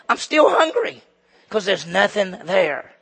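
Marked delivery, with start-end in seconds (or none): 0.1-1.0 high, loud hammed-up complaint; mock hungry complaint. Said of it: "and you go".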